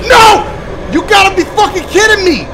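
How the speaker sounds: loud, high yell of disbelief; outraged shout. Real, not acted. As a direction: cry out as the game beats you. Game beats him again.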